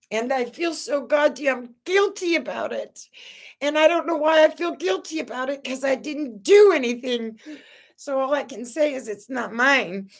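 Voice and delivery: high tearful pleading; crying. Real, not acted. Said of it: "I'm sorry if I'm crying".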